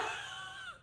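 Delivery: very high, squealing burst of laughter; a high squeal of laughter at her own tears. Retold or imitated. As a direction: let out a shrieking happy laugh. Retelling happy tears.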